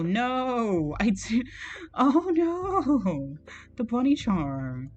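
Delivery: ordinary talk, nothing marked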